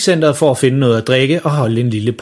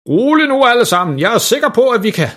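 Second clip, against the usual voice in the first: high, raised plea for calm; beroligende tilråb; 10 semitones higher than usual. A narrator's reading. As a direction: call over a panicking crowd. Julemanden beroliger mængden i panik. (højt, indtrængende tilråb)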